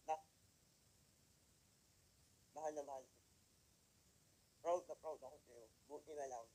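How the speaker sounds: soft, low, tender mother's words; a mother quietly telling her son she is proud; tender mother quote. Retold or imitated. A mother softly tells her son she is proud.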